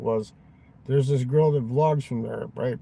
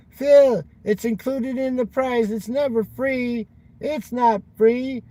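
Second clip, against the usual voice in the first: much higher, louder whiny mimicry; mocking Will's whining; 9 semitones higher, 4 dB louder, slower than usual. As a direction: whine it like the friend who nags him. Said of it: "Remember he used to say".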